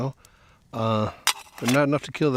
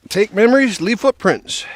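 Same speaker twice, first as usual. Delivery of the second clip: loud, high declared motto; proclaiming; 8 semitones higher, 8 dB louder than usual. Real, not acted. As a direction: announce it like a slogan.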